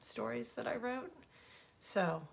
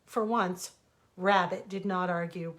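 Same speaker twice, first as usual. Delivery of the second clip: plain reading voice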